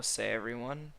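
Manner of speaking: usual speaking voice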